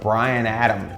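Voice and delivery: ordinary talk, nothing marked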